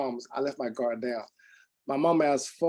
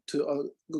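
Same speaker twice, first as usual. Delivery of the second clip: usual speaking voice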